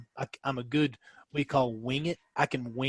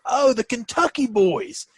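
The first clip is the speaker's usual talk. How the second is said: Bruce's greeting; 9 semitones higher, 4 dB louder, slower than usual. Retold or imitated. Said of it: "he's like".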